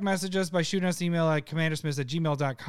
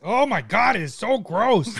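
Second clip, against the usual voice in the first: raised, louder cry of revulsion; real loud disgust; 4 semitones higher, 6 dB louder than usual. Real, not acted. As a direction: exclaim it, grossed out by the taste. Disgusted by the liquor's taste.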